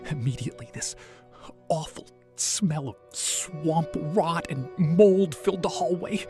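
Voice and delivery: ordinary delivery, nothing marked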